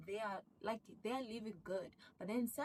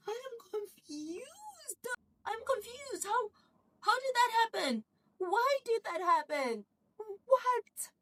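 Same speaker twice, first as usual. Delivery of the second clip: much higher, rising to loud disbelief; bewildered; 12 dB louder than usual. Retold or imitated. Viewer reacting to the twist, high and loud bewilderment. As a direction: throw your hands up in utter confusion.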